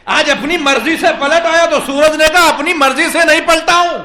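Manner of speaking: high, very loud sermon delivery; impassioned high-pitched shouted sermon; full-force preaching. Acted. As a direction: preach it at the top of your voice.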